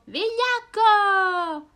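molto acuto, forte e lento; grido sprezzante. Acted. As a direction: grida vigliacco con disprezzo. (gli amici gridano al drago che scappa)

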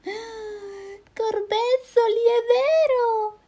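very high, slow loud cry; terrified snake shriek. Acted. Snake seeing the Gruffalo, shrieking very high. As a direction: shriek in horror.

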